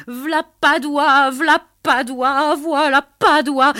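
loud, high, drawn-out whoop of glee; howling with joy; joyful howl. A narrator's reading. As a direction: bellow his name with delight. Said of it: "le fit danser ... en hurlant".

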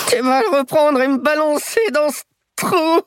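put-on high, pleading voice of a terrified old crook; panicked old man. Terrified old thief begging the boy not to kill him, high panic. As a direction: beg like you're sure he'll shoot.

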